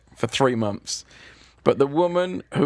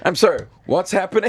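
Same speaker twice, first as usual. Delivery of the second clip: stunned disbelief; 7 semitones higher, 5 dB louder, faster than usual. Retold or imitated. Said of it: "I'm just like".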